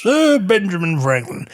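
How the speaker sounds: a mild hint of a stiff founding father; mock old-timey gentleman voice. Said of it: "everybody pictures him talking like this".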